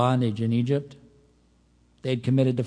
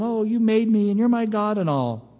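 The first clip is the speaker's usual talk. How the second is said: raised-pitch mimicking of idol worshippers; mock devotion; 6 semitones higher than usual. Preacher imitating people praying to carved idols, mock devotion. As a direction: gush it higher, as a hollow imitation.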